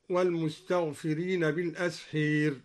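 بالأسحير ending "-heer" for -haar; imala. Shown as a demonstration. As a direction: tilt the last long a of بالأسحير to ee. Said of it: "وأمال أيضًا".

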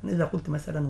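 ordinary talk

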